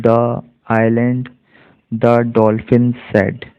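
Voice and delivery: very slow, word-by-word model reading. Shown as a demonstration. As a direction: read slowly, separating each word for learners. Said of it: "word by word".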